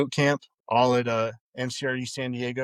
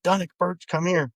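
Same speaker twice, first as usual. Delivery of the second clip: higher-pitched mock command; retold drill instructor call; 7 semitones higher than usual. Drill instructor summoning the two recruits, higher mock bark.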